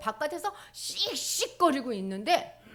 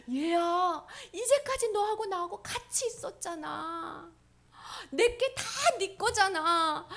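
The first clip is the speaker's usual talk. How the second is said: gentle coaxing father; 11 semitones higher, 6 dB louder than usual. Acted. Father gently coaxes son.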